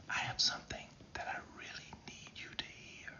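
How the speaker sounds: very soft, drawn-in whisper; barely audible, confidential whisper; quieted voice. Shown as a demonstration. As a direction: whisper it to draw listeners in. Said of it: "I've quieted my voice".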